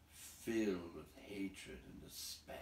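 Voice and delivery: plain reading voice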